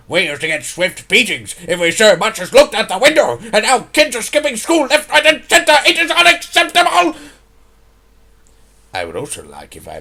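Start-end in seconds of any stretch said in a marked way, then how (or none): 0.1-7.2 building to a high-pitched furious shout; furious rant. Acted. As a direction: lose your temper, climbing to a loud shriek. Rant about truancy boils over.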